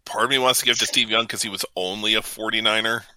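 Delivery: ordinary delivery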